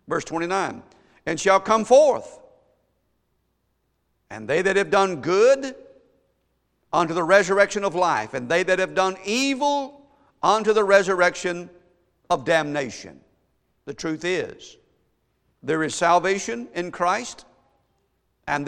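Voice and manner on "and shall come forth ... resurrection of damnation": raised, ringing proclamation in measured phrases; preached scripture reading. Acted. Preacher declaring resurrection to the congregation, ringing preached reading. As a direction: proclaim the resurrection verse loud and high.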